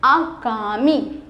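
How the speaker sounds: आगामी drawn out very slowly, every syllable clear; slow model word. Shown as a demonstration. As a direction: stretch आगामी out slowly for the class. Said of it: "आगामी होता है". (आगामी बहुत धीरे, खींचकर)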